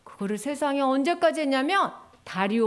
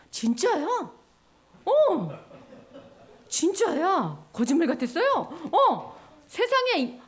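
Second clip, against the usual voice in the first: ordinary talk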